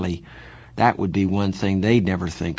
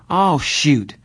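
fake cheerfulness; 7 semitones higher, 6 dB louder than usual. A narrator's reading. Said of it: "with fake cheerfulness".

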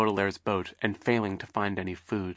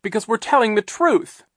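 high, loud protest; boy crying out; 13 semitones higher, 7 dB louder than usual. Acted. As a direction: cry it out in desperate protest. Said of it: "Klaus cried".

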